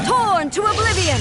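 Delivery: high, forceful attack shout; battle cry. Acted. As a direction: strike and yell it out.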